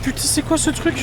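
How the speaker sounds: high panicked question; frightened, high and rising; scared horror game streamer. Real, not acted. Scared after jump scare.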